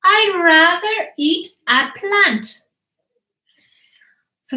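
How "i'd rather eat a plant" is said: high, slow, emphatic complaint; disgusted baby dinosaur. Acted. Baby dinosaur refuses meat before his family, disgusted. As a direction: draw out the distaste in a small high voice. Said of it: "And he said".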